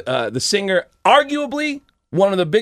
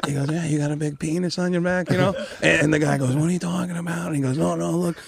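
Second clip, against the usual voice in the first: Adam Sandler. Acted. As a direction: mumble along like the silly comedian. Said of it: "Adam Sandler".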